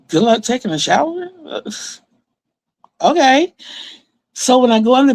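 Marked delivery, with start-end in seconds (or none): 0.1-1.3 higher, questioning repeat; puzzled echo. Retold or imitated. Daughter questioning mom's remark, puzzled. 1.4-3.5 high, rising, doubtful okay; dubious okay. Retold or imitated. Daughter humoring mom, dubious.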